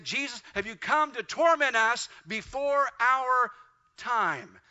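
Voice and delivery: pleading like demons caught off guard; terrified demons crying out. Acted. Demons cry out before Jesus.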